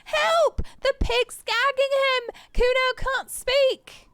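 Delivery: high, loud fake cry for help; bratty kid yelling. Kuno yelling to passersby, high mock alarm. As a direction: shriek it like a brat faking distress.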